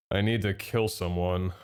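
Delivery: ordinary talk, nothing marked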